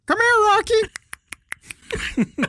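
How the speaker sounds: high, very slow drawn-out call; sing-song bird calling out; parrot calling the dog. Retold or imitated. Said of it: "the African gray goes".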